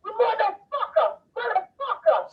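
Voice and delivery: high, drawn-out mock screams at no extra volume; imitated screaming. Woman imitates Ace screaming at the mover on the phone, high mock screaming. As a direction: mimic someone shrieking curses, stretching each word. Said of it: "Ace immediately started going".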